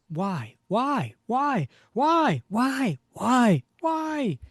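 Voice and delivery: high, loud wailing reproach; hammed-up haunting with repeated swooping cries; accusing ghost. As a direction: wail the blame, climbing at the end. Voices Bucky's accusing ghost.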